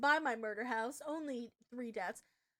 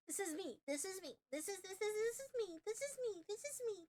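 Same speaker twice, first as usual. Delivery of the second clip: high, softer giddy chant; hushed excitement; 6 semitones higher, 7 dB quieter than usual. Real, not acted. Streamer chanting excitedly yet quietly. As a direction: squeal it under your breath.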